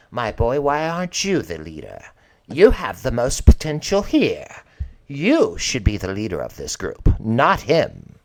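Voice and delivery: loud, emphatic matriarch urging him on; Thelma, imperious patron. Acted. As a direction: push each point forcefully, like a grand lady. Voices Thelma urging Americus.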